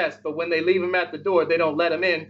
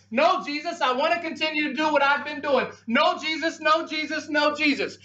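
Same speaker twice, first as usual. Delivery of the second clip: high, pleading no to Jesus; pleading refusal; 7 semitones higher than usual. Retold or imitated. Reluctant sinner refusing Jesus, higher pleading. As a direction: beg Jesus to stay out.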